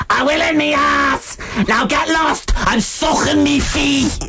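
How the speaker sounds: gruff high shout; rough shouting woman. Retold or imitated. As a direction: bark it out coarsely. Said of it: "The nicest thing she said".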